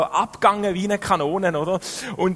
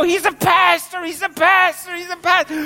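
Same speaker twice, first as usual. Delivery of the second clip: high, loud, gleeful repeated cry; excited English shouting; 11 semitones higher, 4 dB louder than usual. Retold or imitated. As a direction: announce it to your mate, thrilled. Excited clubber tells friend.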